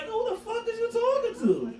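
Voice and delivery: high-pitched indignant challenge, retold. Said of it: "Well, you raising your voice".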